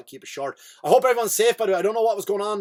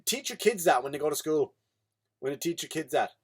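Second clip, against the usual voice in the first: ordinary talk, nothing marked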